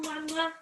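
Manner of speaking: playful smacking kisses; kiss sounds. Shown as a demonstration. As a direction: blow two kisses to the floor. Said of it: "kiss the floor".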